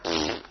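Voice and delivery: low blown raspberry; raspberry noise. Retold or imitated. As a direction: blow a raspberry at the baby. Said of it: "and I went".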